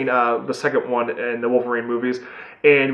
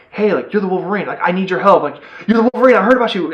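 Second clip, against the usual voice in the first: higher, urgent imitated woman's voice; mock pleading, pitched up; 6 semitones higher, faster than usual. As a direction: voice an eager woman begging a hero for help. Retold woman pleading for help.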